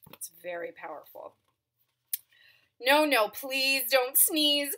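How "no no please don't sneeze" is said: pleading monkeys. Acted.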